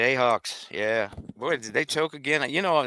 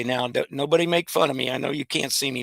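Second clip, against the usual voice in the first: usual speaking voice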